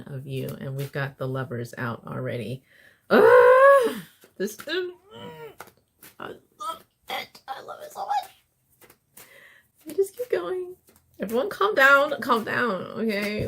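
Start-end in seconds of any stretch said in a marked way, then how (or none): 3.1-10.8 very high, breathy delight; excited squeal. Real, not acted. Delighted squeal over the Lovers. 11.2-13.5 high and loud, barely contained; giddy excitement. Real, not acted. Giddy, calming herself and viewers.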